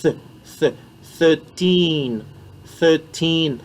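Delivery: th said with the tongue between the teeth; th sound. Shown as a demonstration. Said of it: "Ici, on va dire th". (th de thirteen, langue entre les dents)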